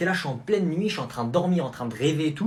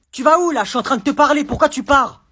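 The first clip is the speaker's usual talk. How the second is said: loud, higher furious mom; angry mother; 11 semitones higher, 8 dB louder than usual. Acted. Mother yelling at her son over his grade. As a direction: demand an answer about the grade.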